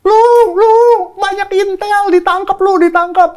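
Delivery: high loud warning shout; retold yell from above. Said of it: "Dia di atas teriak". (teriakan memperingatkan dari atas)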